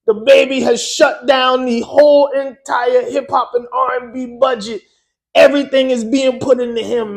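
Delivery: put-on loud, high Caribbean accent, near shouting; excited Caribbean-accented gossip, loud and high; island accent. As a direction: hype it up in the island DJ's voice. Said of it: "He's from the island".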